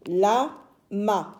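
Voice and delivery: "La, ma" split into two separate syllables; "La", pause, "ma". Shown as a demonstration. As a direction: read "La, ma" as two beats with a gap. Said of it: "Nous avons deux syllabes".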